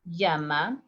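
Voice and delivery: Llama said as "yama"; y sound. Shown as a demonstration. Teacher demos llama with y. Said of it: "the three different ways".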